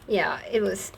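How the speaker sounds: ordinary talk, nothing marked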